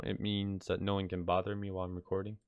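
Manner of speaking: ordinary delivery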